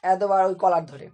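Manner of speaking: normal talking voice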